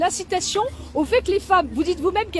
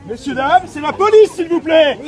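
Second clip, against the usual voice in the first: raised voice over the crowd; calling out loud; 5 dB louder than usual. Real, not acted. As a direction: call out to the crowd firmly.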